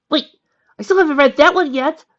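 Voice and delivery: high, loud burst of discovery; excited realisation. A narrator's reading. Bored sick girl spotting an unread book, excited exclamation. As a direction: exclaim it, suddenly thrilled.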